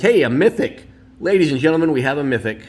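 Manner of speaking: loud, excited call of a mythic pull; excited announcement. Real, not acted. Collector showing off a mythic pull, announcing it loudly to viewers. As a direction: ring it out like a showman.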